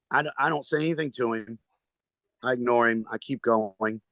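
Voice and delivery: normal talking voice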